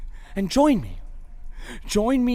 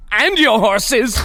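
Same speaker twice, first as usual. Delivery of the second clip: high, loud, drawn-out afterthought; shouted threat; 5 semitones higher, 5 dB louder than usual. Acted. Prisoner adding to his threats, indignant shout. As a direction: yell one last threat after them.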